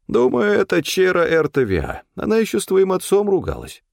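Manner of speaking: high-pitched character voice, well above the narration; Стефан. A narrator's reading. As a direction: voice Stefan high, sharing his suspicions. Stefan names a suspect. (высокий голос персонажа)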